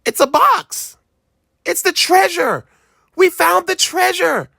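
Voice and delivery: put-on high, bubbling boy voice; a kid beside himself with joy; excited child. A narrator's reading. Boy telling his dad about the box, excited child voice.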